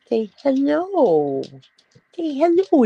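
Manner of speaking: high cooing voice urging the puppy to greet viewers; coaxing pet voice. Real, not acted. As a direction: coo at the puppy to greet everyone.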